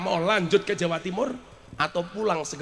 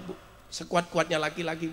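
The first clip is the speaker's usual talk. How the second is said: ordinary delivery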